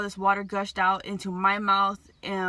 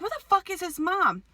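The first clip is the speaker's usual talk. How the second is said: much higher panicked shout; alarmed cry across the pool; frantic yell; 8 semitones higher than usual. Retold or imitated. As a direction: demand to know where the mother is. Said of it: "I'm like".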